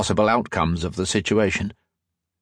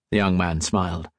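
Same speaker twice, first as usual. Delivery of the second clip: plain reading voice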